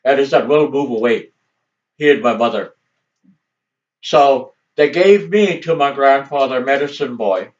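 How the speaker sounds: ordinary delivery, nothing marked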